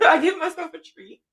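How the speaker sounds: repeats the line through a laugh. Real, not acted. Creator telling viewers about her treats, laughing. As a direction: laugh it out, amused at herself.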